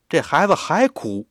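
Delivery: ordinary delivery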